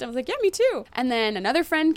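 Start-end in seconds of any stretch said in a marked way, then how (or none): 0.3-0.8 fake cheerful. Faking cheer to friend.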